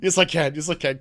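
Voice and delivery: usual speaking voice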